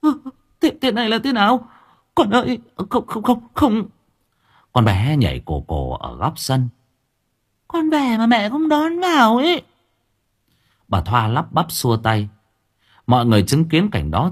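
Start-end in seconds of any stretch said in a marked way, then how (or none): 0.0-3.9 high, rushed panicked refusal; the mother recoils from her possessed daughter; terrified denial. Acted. 7.7-9.6 put-on much higher, eerie child's cry; a ghost calling through a little girl; the possessed child calls to her mother; shrill possessed child.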